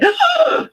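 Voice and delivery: high, sharp mock gasp; acted startled gasp. Host acting out guest's fright at the screen, mock startled gasp. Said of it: "You're gonna go".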